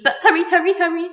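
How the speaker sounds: high, loud hurry; impatient urging. A narrator's reading. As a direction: push him to be quick.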